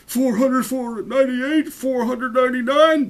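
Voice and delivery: tired Sir Alaric counts as he reaches the top. A narrator's reading. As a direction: drag out the count, worn out from the climb. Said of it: "broke in the tired voice of Sir Alaric".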